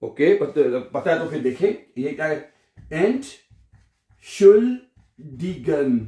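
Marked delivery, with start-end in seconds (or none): 2.9-6.0 Entschuldigen stretched very slowly, syllable by syllable; drawn-out model word. Shown as a demonstration. Teacher teaching excuse me in German, extremely slow. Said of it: "teacher models long word".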